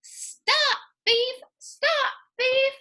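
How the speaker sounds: high hollered chase cry; hollering. Acted. Granny chasing the thief with her stick, hollering high. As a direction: holler it after the fleeing thief. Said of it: "hollered too".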